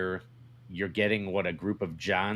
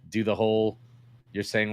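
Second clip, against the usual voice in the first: ordinary talk, nothing marked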